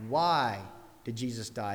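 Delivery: "Why" set apart by a pause, the rest dropping low; stress on Why. Shown as a demonstration. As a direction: hit "Why", pause, then let it fall. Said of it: "emphasis was, "Why".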